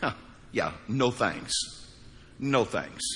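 quiet, scornful no thanks; dismissive brush-off. Retold or imitated. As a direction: wave them off with a low, cold voice. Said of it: "would look at the people of Moab and say".

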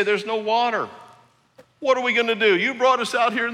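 hammed-up whining crowd; whining Israelites. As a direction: whine at Moses like spoiled complainers. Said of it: "This time they say".

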